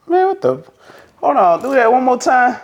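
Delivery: high, disbelieving exclamation; amazed director. Retold or imitated. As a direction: imitate a director who can't believe it.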